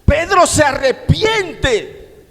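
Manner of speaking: slow, high shouted "Pedro se arrepiente"; shouted proclamation. Acted. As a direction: declaim it slowly at the top of the voice. Proclaimed climax line. (proclamación gritada y lenta)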